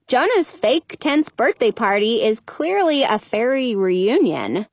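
usual speaking voice

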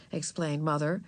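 plain reading voice

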